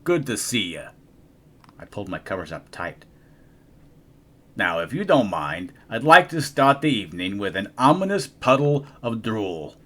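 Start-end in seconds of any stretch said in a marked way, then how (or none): 0.0-0.9 growling monster. Acted. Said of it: "Gabe growled". 4.5-9.8 sly monster voice. Acted. Gabe the monster speaks.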